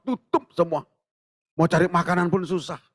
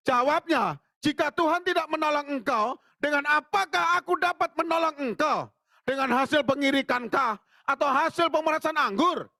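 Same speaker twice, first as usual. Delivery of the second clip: scripture reading; 11 semitones higher than usual. Acted. Pastor reading the verse to the congregation, high lifted reading.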